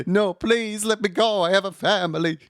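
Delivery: high, pleading mock plea for mercy; begging for my life. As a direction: beg like a dangling keychain figure. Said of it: "pleading for his life".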